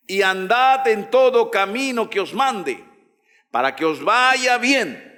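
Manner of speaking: high, declamatory preacher's reading; scripture proclamation. Acted. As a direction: proclaim each phrase to the congregation.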